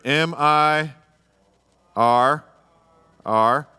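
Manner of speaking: each letter of M I R R said apart, loud and firm; spelled out letter by letter. Shown as a demonstration. Pastor spells out mirror to the men, firm and loud. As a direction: punch out M, I, R, R one at a time. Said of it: "How you spell mirror?".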